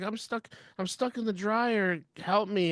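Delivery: high, slow mock plea for help; put-on helpless wife voice; stuck damsel. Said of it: "And she's like".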